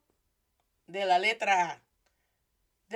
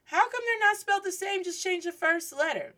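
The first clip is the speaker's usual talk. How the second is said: high, insistent questioning voice; puzzled questioner; 10 semitones higher than usual. Acted. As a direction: ask it high-pitched, like a baffled learner. Voices a puzzled questioner before answering.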